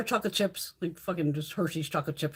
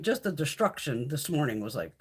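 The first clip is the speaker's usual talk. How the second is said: normal talking voice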